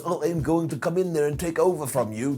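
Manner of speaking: imitating Vlad in a badly faked accent; clumsy accent for a wrestler; bad put-on accent. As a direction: do a terrible mock accent. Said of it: "the worst accent I've ever heard".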